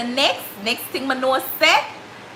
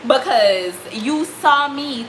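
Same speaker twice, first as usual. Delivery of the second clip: normal talking voice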